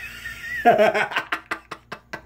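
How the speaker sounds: breathy villain laugh; evil chuckle. Acted. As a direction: give a sinister little laugh. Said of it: "you'd be the best villain. Uh, laugh ever".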